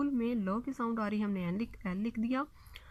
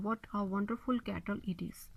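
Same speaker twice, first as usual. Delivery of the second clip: whispered. Shown as a demonstration. Corrected example sentence, whispered.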